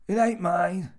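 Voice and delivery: high, loud retort; defensive old man. A narrator's reading. Old man answers Jimmy about the joke book, defensive. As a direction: deny it loudly, pitched right up.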